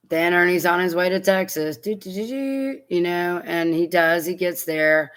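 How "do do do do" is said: high-pitched, rising doo-doo-doo travel noise; sing-song little mimicked motoring-along sound; travel ditty. Mimics trip to Texas.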